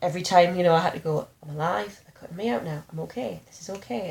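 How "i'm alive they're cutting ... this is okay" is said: soft, measured self-reassurance; calm. Retold or imitated. Reassuring oneself while being cut out, calm and soft. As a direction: quietly check in with yourself. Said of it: "being calm about it".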